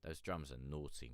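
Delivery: usual speaking voice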